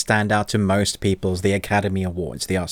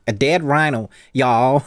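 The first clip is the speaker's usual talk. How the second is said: higher-pitched mock Southern twang; Southern American accent; 4 semitones higher, slower than usual. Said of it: "Yeah, it went very southern".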